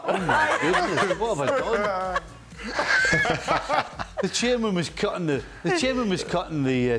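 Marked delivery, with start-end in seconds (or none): 0.0-2.2 mock dismay. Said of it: "I was like". 2.2-4.2 a high, rising laugh. Real, not acted. Laughing at own story.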